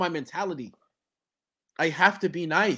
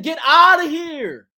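loud, slow, higher jeer; mock dismissal; 8 semitones higher, 9 dB louder, slower than usual. Cipher crowd shouting off a weak freestyler, loud and slow. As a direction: boo him off the stage.

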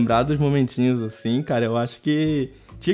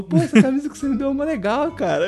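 imitating a friend; 12 semitones higher than usual. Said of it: "aí ele falou".

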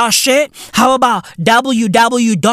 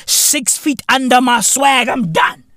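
normal talking voice

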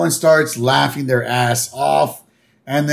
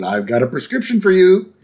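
higher goofy cartoon voice; higher Homer impression; 7 semitones higher than usual. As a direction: play the dopey dad, pitched up. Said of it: "Homer goes".

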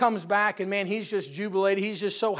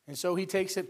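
ordinary talk

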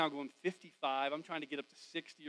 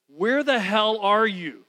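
slow, loud, higher, furious; angry yell; 5 semitones higher, 12 dB louder, much slower than usual. Retold or imitated. Pastor on a borrowed phone yelling at his wife, slow angry shout. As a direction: bite out each word, fed up. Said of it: "it's the accurate words I said".

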